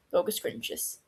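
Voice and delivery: plain reading voice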